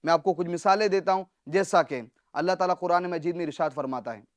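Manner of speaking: usual speaking voice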